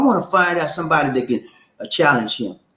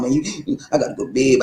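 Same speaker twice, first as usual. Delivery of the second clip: ordinary talk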